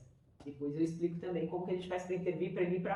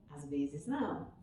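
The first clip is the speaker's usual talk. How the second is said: soft repeat; 5 semitones lower, 6 dB quieter than usual. Shown as a demonstration.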